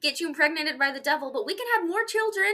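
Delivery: breezy sales pitch from a scheming husband, high and loud; mock Guy. Imitating Guy justifying himself to his wife, high and loud. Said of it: "Guy is like".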